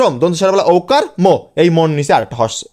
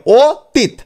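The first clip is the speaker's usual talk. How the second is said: ও held apart from তিত; syllable break; 6 semitones higher, 3 dB louder, much slower than usual. Shown as a demonstration. Teacher asks where she stops, ও and তিত said separately. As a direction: say "ও... তিত" in two pieces. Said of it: "আমি থামছি কোথায়".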